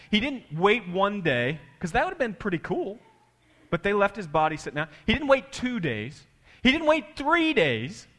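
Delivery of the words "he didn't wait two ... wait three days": building emphasis. Real, not acted. Preacher building emphasis on days.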